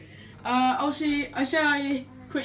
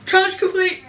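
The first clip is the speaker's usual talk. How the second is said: excited; 5 semitones higher, 7 dB louder than usual. Real, not acted. After the ice plunge.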